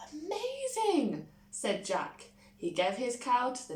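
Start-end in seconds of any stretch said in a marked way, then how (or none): 0.1-1.3 squealed high in wonder; amazed. A narrator's reading. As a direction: marvel like a wide-eyed child. Jack amazed by beanstalk tale.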